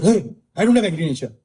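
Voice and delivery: mildly indignant acted protest; mock-angry husband; angry denial. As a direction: act out an irritated husband denying his temper. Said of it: "He's angry and he's saying".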